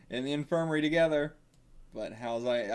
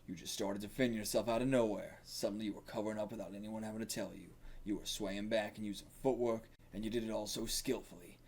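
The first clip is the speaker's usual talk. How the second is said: gravelly; 2 semitones lower, 8 dB quieter than usual. Acted. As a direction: explain quietly with a touch of gravel. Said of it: "it's just gonna be gravelly".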